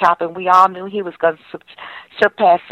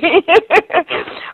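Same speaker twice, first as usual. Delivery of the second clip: high real laugh; 10 semitones higher than usual. Real, not acted. Laughs about stories of Willie.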